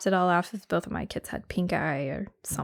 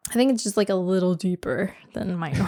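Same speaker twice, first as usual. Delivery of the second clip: sick voice. Real, not acted. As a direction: talk with a lingering cold in the voice. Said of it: "my voice kinda sounds like this too".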